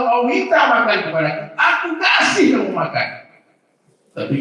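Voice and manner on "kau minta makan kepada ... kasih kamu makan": high, loud proclamation; loud, lifted voice of the promise; booming divine promise. Acted. Voicing God's promise.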